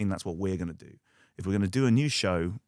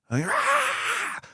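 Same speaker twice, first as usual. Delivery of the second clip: mock terrified yelp. Voicing the Saxons' terror.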